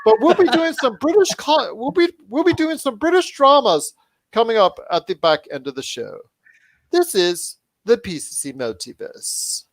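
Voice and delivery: high-pitched mock British announcer; horrible British accent. Host signing off to the break, hammed-up British accent. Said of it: "horrible British accent".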